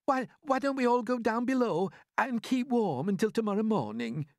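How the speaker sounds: high, loud Miss Spider voice; Miss Spider suggesting. Acted. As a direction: suggest going below. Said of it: "Miss Spider suggested".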